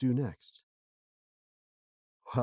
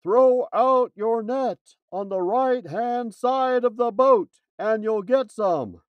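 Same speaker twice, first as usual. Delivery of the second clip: slow, loud, high call from shore; 10 semitones higher, 8 dB louder, slower than usual. Acted. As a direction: call across the water, slowly. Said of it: "The man on the shore called".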